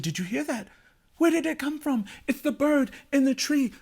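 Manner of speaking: put-on high-pitched, curious children; wondering children. A narrator's reading. Children hear the bird in the tree, full of wonder. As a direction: voice excited little ones up high.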